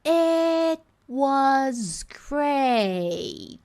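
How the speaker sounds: extremely slow, stretched words; slow motion. Shown as a demonstration. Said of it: "quando eu coloco em slow motion". (bem lento, em câmera lenta)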